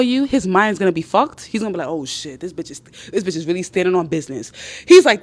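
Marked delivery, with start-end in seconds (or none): 1.9-4.5 low, hushed aside to himself; imitated awe, dropped soft and low; stunned realization. Man realising she won't be derailed, stunned.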